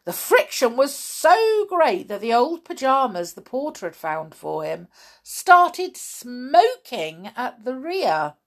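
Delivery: ordinary delivery, nothing marked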